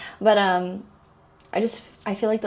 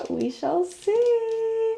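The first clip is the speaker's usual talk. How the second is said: very slow and much higher, hammed-up suspense; mock-ominous tease; 13 semitones higher, much slower than usual. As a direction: stretch it out like a playful cliffhanger. Teasing about his acting.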